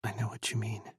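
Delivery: soft, hushed reply; gentle near-whisper. A narrator's reading. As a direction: answer just under your breath. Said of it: "Chris whispered".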